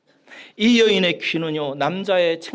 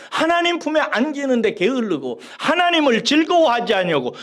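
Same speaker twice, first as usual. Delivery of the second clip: raised, high preaching voice building to a peak; fervent preaching; 5 semitones higher, 4 dB louder than usual. Acted. Pastor urging the congregation toward God, fervent preaching. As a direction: preach it out with rising fervor to the congregation.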